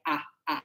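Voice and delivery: "ah, ah," as a soft open ah with no r; Australian ah ending. Shown as a demonstration. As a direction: say "ah, ah," quietly, non-rhotic. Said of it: "So we say in Australia".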